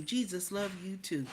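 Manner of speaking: usual speaking voice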